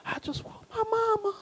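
high, soft mock-crying little girl; high whining little girl, hammed-up tears; whiny child voice. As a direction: whimper it like a sleepy child wanting mom. Daughter's reply, mock-whiny.

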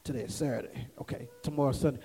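very low, hushed mumbling to oneself; barely audible, stalling self-talk; thinking to oneself. Acted. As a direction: mimic someone hurriedly working out the day under their breath. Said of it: "they have to think about it".

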